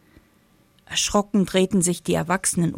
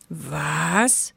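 erschrocken; 3 semitones lower, 3 dB quieter than usual. Acted. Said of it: "fragte Mama erschrocken".

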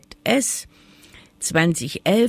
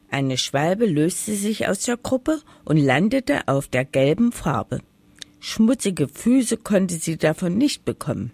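ordinary delivery

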